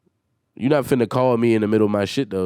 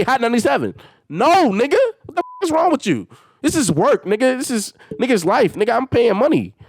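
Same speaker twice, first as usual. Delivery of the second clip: venting hard at a flaky brother; heated rant; 10 semitones higher than usual. Real, not acted. Ranting at his brother about podcast prep, exasperated and high.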